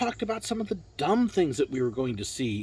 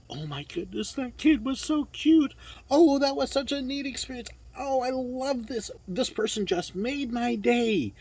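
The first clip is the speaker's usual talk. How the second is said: much higher, bubbly excited chatter; gushing delight; 10 semitones higher than usual. Retold or imitated. Cast members gushing backstage about guests, excited and much higher. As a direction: gush like you just met the cutest kid.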